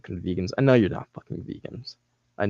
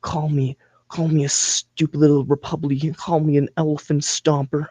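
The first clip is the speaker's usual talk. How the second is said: higher, louder, put-on begging voice; mock begging viewer; 5 semitones higher, 4 dB louder than usual. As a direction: beg to be insulted, hammed-up. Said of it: "You're probably just like".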